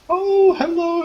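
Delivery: put-on very high, sing-song old aunt's hello; doting aunt. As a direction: coo hello like a doting auntie. Stout aunt greets nephew.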